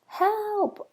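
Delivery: high panicked squeak; frightened squeak. Acted. Lost mouse calling into the rainy night, high panicked squeak. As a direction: cry out like a scared mouse. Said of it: "I squeaked into the dark".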